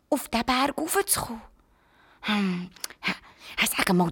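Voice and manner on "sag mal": usual speaking voice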